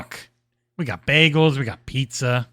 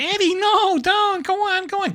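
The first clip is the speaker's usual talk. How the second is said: much higher, whiny plea; high mock pleading; 13 semitones higher than usual. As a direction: beg him hammily. Acting Wallis pleading.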